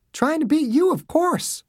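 put-on high, light girlish retort; cheeky daughter. A narrator's reading. Daughter answering her angry father, cheeky. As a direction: answer back as if it were obvious.

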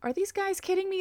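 high, disbelieving cry at the screen; can't believe what he's seeing; exasperated disbelief. Real, not acted. Fan ranting at the TV in high disbelief. Annoyed at the pregame delay.

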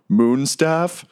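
high, rising theatrical wail; hammed-up anguish, drawn out at the end; tearful lament. Game master's character bemoaning his mistake, tearful lament. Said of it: "he starts weeping onto the table".

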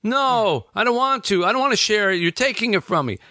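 high, mock-whining child; imitating whiny child. Child refusing to share a toy, mock whine. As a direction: whine it like a kid who won't share. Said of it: "they start crying".

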